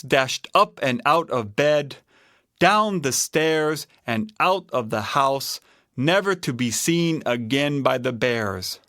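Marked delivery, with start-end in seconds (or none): none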